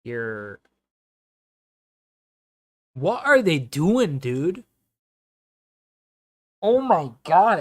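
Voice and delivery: loud, high disbelief at the defending; real exasperated yell; exasperated outburst. Real, not acted. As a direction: throw your hands up, yelling at the screen. Outraged at Everton's play.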